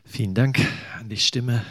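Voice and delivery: ordinary talk, nothing marked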